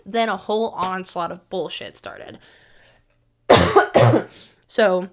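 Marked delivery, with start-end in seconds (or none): 3.5-4.4 loud sudden cough; a sharp, loud cough; real cough. Real, not acted. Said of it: "when I cough".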